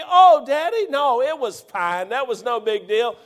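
high, dismissive daughter voice; mock casual daughter; mimicking daughter. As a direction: brush it off like a breezy teen. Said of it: "you'll bother to tell me".

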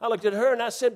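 usual speaking voice

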